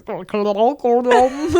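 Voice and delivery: playfully attempted Northern Irish accent; hammed-up Northern Irish attempt. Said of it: "He actually is Northern Irish".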